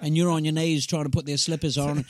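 ordinary talk, nothing marked

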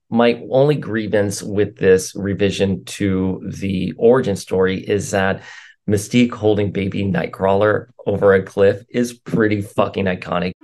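usual speaking voice

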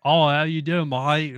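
American hamming up an Australian twang; overdone Aussie accent. Retold or imitated. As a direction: overdo the Aussie with a big mouth. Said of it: "talk really with their mouth".

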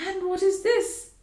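a wondering question from Georgiana; curious young wife. A narrator's reading. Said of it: "asked Georgiana".

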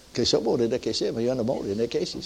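real laughter through foreign syllables; laughing in tongues. Real, not acted. Preacher overcome with joy, laughing through tongues. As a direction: let laughter carry the strange words.

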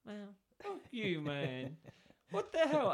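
high, loud, incredulous protest; drawn-out start rising into a high, loud outburst; exasperated outrage. Retold or imitated. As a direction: act out indignant disbelief, half laughing. Acted outraged reaction to smug customer.

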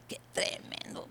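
unvoiced hushed whisper of awe; whispered aside. Preacher recalling her reaction to Job, whispering. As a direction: breathe it out in a whisper. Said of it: "dije yo".

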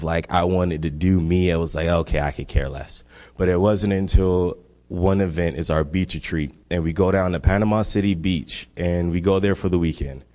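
ordinary talk

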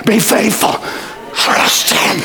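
usual speaking voice

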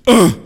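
short, loud wordless grunt. Acted. Dropping an invite in someone's lap, loud grunt. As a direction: grunt abruptly instead of speaking. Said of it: "comes up to you and grunts".

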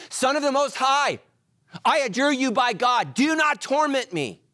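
high, loud, slowed pleading cry; a possessed man's loud plea, pitched high; anguished loud cry. Retold or imitated. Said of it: "crying out with a loud voice".

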